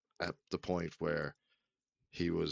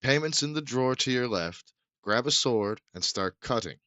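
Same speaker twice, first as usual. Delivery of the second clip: louder, higher shouted orders; yelling; 7 semitones higher, 7 dB louder than usual. A narrator's reading. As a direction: call it across a busy room. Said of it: "Silas yells over to you".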